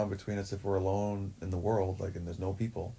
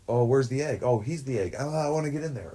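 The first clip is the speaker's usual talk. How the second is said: higher, louder, eager imitation of the other girl; mock eager girl; 5 semitones higher, 4 dB louder than usual. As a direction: mimic her grabbing for the egg, a bit hammed-up.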